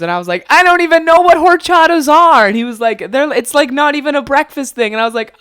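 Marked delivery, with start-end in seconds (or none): 0.5-2.5 high, loud retold protest; mock hysterical yell. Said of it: "we just continued to die laughing". 3.1-4.9 high-pitched retold objection; imitating hysterical friend. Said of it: "we just continued to die laughing".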